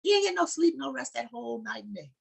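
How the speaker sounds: stirring sermon climax; fervent preaching. Acted. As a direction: preach it up to the congregation. Preacher building to full force.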